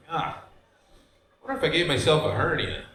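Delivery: ordinary delivery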